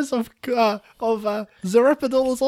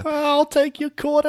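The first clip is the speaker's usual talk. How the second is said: put-on higher, cheeky street-kid voice; little orphan boy; 4 semitones higher than usual. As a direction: play a scrappy little boy. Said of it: "I'm a little orphan boy".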